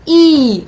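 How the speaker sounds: ई held long and loud; long vowel ई. Shown as a demonstration. Teacher demonstrating dirgha vowel's pronunciation, long ई. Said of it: "और इसका उच्चारण होता है".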